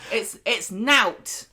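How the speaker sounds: nout said slowly with a northern vowel; drawn-out northern nout, very slow. Shown as a demonstration. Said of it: "Say nout".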